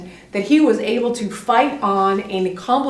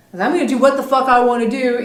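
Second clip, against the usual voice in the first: fast, louder mock defiance; adamant; 3 semitones higher, 5 dB louder, faster than usual. Coach imitating the student's take on the scene, adamant. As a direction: push it out quickly, digging in. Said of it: "You were pretty adamant about it".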